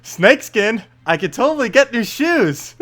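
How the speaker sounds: high-pitched mock teen-girl excitement; hipster teen girl. Streamer as princess thrilled about shoes, hipster teen girl voice. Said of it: "fun to do an accent to".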